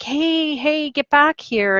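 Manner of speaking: put-on high calls after a runaway child; imitating a parent chasing a child; parent chasing kid.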